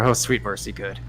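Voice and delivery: ordinary talk, nothing marked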